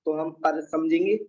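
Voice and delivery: normal talking voice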